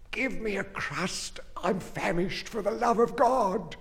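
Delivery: high, soft famished moan; groaning plea. Acted. Dying wife begs her husband for a crust, high soft groan. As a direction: beg weakly for bread, high and hushed. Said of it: "she groaned".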